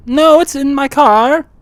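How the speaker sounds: loud clerk character. Acted. Clerk Steve brags about his name tag and car, loud and deadpan. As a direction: play the earnest oddball attendant.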